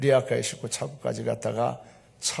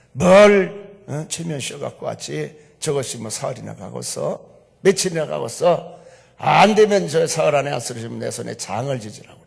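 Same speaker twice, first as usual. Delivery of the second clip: scornful uncle; 8 dB louder than usual. Retold or imitated. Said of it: "하는 얘기가".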